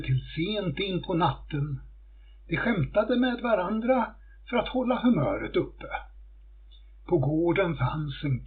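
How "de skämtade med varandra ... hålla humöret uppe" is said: usual speaking voice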